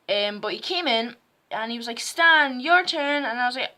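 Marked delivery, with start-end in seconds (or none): 2.0-3.2 raised-voice classmate summons; calling out. Retold or imitated. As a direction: imitate a kid calling out, loud and higher. Kid calls narrator's turn in story.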